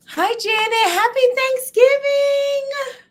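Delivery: high, slow, bright hello to a viewer; excited greeting. Real, not acted. Host greeting a chat viewer on Thanksgiving, excited and high. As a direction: sing out a happy hello.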